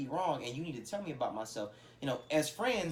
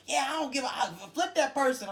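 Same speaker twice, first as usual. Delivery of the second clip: high, loud, gassed-up outburst; imitated hype man, worked up and indignant; hyping someone up; 12 semitones higher, 6 dB louder than usual. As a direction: shout like the friend egging you on. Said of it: "hyping me up and gassing me up".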